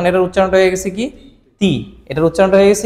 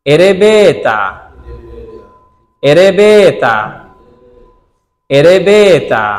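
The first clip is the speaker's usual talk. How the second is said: এরেবেতা said slowly and clearly, syllable by syllable; teacher modelling এরেবেতা carefully; slow teaching demo; much slower than usual. Shown as a demonstration.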